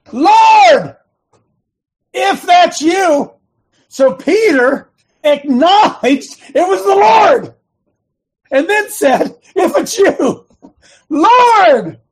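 high, loud, stretched-out cries; full-force preaching shout. Acted. Preacher voicing Peter acknowledging the Lord, full-force shout. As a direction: belt it out, dragging the key words.

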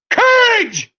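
COURAGE pitched very high; climactic repeat. Real, not acted. Preacher hammering the quote's key word, high climactic COURAGE. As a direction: send COURAGE right up as the climax.

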